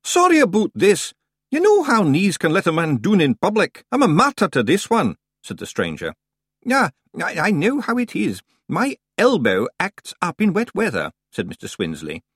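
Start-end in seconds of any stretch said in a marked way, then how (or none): 0.0-5.2 rough Scottish brogue, louder; a rustic Scot at the door; Scottish-ish stranger. A narrator's reading. Stranger Scots. 6.7-11.1 high, hesitant, distracted old librarian; Senior Librarian Swinsley. A narrator's reading.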